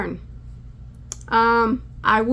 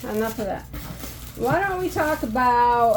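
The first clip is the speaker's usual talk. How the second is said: ordinary delivery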